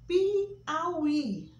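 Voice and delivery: Piauí loud and high, í stressed. Shown as a demonstration. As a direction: call out Piauí hitting the final í. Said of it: "acento agudo no I". (Piauí com í tônico, forte)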